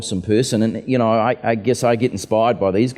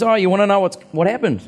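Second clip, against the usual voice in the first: higher, a little louder imitated cheerful offer; John eagerly offering to tell his story, in a higher imitated voice; cheery offer; 12 semitones higher, 4 dB louder than usual. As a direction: play him eagerly offering the story, pitched up.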